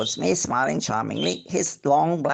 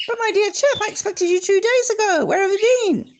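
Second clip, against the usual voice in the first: very high, louder, eager host voice; excited host; 4 dB louder than usual. Acted. Host scolding the late guest in a high, loud, eager voice. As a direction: gush at him, high and bright.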